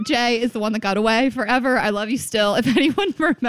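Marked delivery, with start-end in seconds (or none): 2.5-3.5 high-pitched words broken by real laughter; laughing. Real, not acted. Host appealing to listeners about old crush, laughing.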